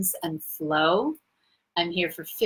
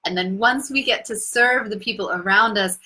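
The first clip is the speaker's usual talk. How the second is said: ordinary delivery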